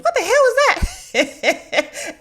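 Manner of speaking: high, slow, laughing shriek; shocked laughing scream at the video; laughing yell. Retold or imitated. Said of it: "Karen yells".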